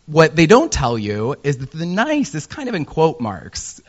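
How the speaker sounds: usual speaking voice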